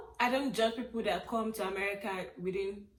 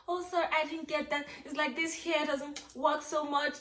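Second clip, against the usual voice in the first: higher, put-on apologetic voice; polite apology; 5 semitones higher than usual. Imitating people who don't want you to feel bad, apologetic. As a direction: imitate someone sweetly excusing their hearing.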